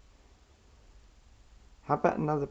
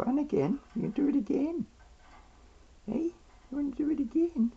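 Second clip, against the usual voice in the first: high coaxing pet talk; faster than usual. Real, not acted.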